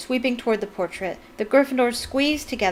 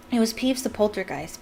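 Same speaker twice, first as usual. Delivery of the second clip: ordinary delivery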